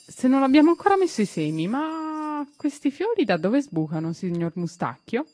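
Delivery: voce di bambino leggermente accennata, perplessa; un bambino che chiede stupito; bambino curioso. A narrator's reading. Tistù voiced as a child. (Tistù domanda al giardiniere, con curiosità ingenua)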